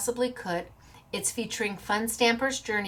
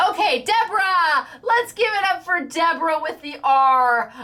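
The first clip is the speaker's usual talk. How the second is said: higher, louder emcee call; cheering announcement; 6 semitones higher, 4 dB louder than usual. Acted. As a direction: give it up for the winner. Announcing winner after giving up.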